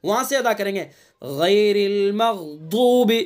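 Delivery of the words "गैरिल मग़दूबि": slow melodic tajweed chant; Quran recitation. Shown as a demonstration. Teacher reciting the verse slowly for students. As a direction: draw each syllable out in recitation.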